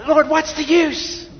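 high, slow, weary plea of what's the use; a preacher throwing up his hands in prayer; discouraged sigh to God. Retold or imitated. Preacher's thought to the Lord when nobody listens, weary.

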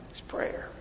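breathless whisper of the answer; hushed reveal; whispered point. Real, not acted. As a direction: whisper it like a secret. Hushed key point of sermon.

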